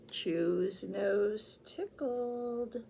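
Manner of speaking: usual speaking voice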